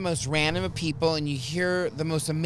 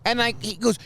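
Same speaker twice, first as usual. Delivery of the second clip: ordinary talk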